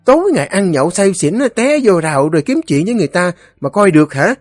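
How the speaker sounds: very high, rapid nagging tirade; scolding wife. A narrator's reading. Wife scolds her drunken husband for picking fights, scolding. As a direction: berate him shrilly, barely pausing.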